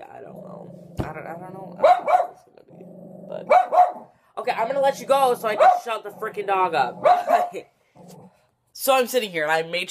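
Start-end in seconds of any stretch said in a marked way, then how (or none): 1.8-5.7 high, loud squeaky voice; silly squeak. Real, not acted. Vlogger signing off the clip in a high squeaky voice. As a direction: pipe it up like a cartoon.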